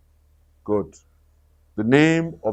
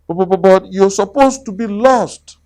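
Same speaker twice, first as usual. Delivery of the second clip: stunned stammer; 7 semitones higher, faster than usual. Acted. Rabbit stammering surprised.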